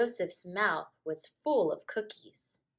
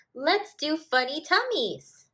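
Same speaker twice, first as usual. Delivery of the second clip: put-on higher, louder kid shouting a game idea; playful shout; 8 semitones higher, 6 dB louder than usual. A narrator's reading. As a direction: shout it out to the other kids. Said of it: "Danny was shouting".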